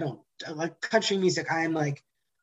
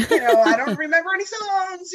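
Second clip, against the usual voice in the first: mock panic; 11 semitones higher, 5 dB louder than usual. Acts out her panicked excuse.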